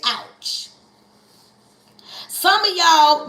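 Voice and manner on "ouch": quiet, breathy little ouch; a hushed pained ouch; soft ouch. Shown as a demonstration. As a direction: say it softly, like it stung a bit. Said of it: "Just say".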